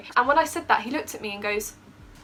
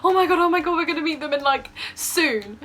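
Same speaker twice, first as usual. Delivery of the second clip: excited; 5 semitones higher, 3 dB louder than usual. Retold or imitated. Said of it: "We were there like".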